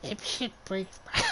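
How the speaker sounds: high, strained tight-throated voice. Acted. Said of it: "I remember tightening my throat up".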